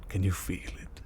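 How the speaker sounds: normal talking voice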